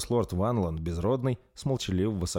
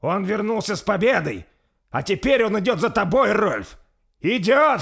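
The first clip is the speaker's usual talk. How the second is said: much higher, louder gloating cry; triumphant threat; 13 semitones higher, 9 dB louder than usual. A narrator's reading. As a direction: taunt the prince triumphantly. Bersi threatens. (Берси торжествующе угрожает Рольфу)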